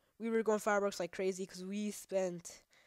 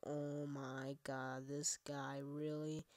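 slow, softer, fed-up disbelief; exasperated; 4 semitones lower, 7 dB quieter, much slower than usual. Retold or imitated. Teen retorts to the cop, slow and fed up. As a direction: drag it out, unimpressed. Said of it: "I'm just like".

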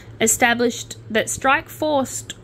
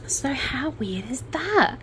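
whisper; 4 semitones higher, 5 dB quieter than usual. Real, not acted. Whispered aside to viewers.

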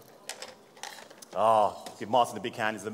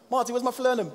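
high-pitched call to a colleague; mock complaint; 10 semitones higher, faster than usual. Bartender asking Marty for his missing falernum, high mock complaint. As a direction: call out in playful protest, pitched well up.

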